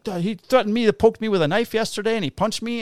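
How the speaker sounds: high-pitched complaint. Retold or imitated. Quoting a complaint about being threatened with a knife, high-pitched mimicry.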